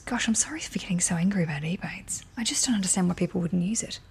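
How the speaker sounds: soft whispered apology; sheepish whisper. Real, not acted. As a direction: drop to a whisper, a bit embarrassed. Apologising for getting angry.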